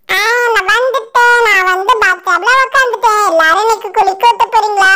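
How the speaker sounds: a cheeky magic doll settling into the bath; talking doll. Acted.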